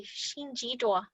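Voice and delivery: "Xin ji duo." said softly with a falling end; Hmong farewell demo. Shown as a demonstration. Teacher showing the class how you say it, careful Hmong demonstration. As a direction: model "Xin ji duo." for learners, with the n sounded in "Xin". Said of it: "this is how you say it".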